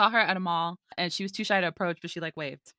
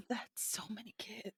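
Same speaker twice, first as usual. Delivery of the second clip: hushed whisper of disbelief; 16 dB quieter than usual. Real, not acted. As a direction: whisper it in shock. Shocked aside.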